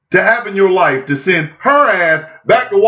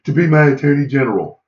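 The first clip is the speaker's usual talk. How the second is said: imitating Trump; 5 semitones lower than usual. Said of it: "Donald Trump ... said".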